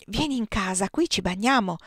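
ordinary delivery

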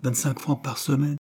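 name the figure with embarrassment; timid answer at normal volume; hesitant. A narrator's reading. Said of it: "Jane hésita, puis en rougissant".

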